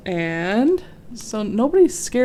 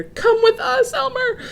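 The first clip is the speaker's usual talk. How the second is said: calling out. A narrator's reading. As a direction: call to Elmer while running. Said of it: "called the elephants".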